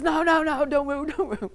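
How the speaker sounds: very high, loud panicked cry; frantic shout. Retold or imitated. Ranger yelling at the bouncing climber to stop, high panicked shout. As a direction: plead in a high, loud squeal. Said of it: "He says".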